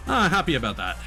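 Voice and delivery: ordinary talk, nothing marked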